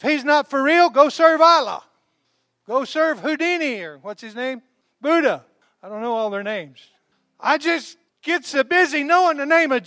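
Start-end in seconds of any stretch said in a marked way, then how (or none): 0.0-5.5 louder, higher challenge to the crowd; defiant preaching shout. Real, not acted. Preacher dares the congregation to serve another god. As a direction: dare them to go elsewhere.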